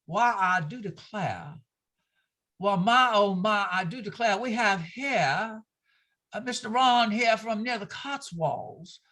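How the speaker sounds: slow, genteel Southern drawl; a hint of a Southern belle declaring; Southern belle accent. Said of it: "this whole kind of thing here".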